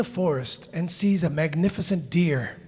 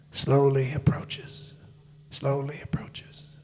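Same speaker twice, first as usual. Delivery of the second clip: low, slow, drawn-out words, sinking softer; creeping menace; 6 semitones lower, 4 dB quieter, slower than usual. A narrator's reading. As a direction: stretch it out as it creeps closer. Tiger creeps toward the hunter.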